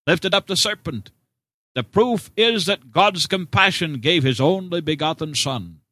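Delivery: normal talking voice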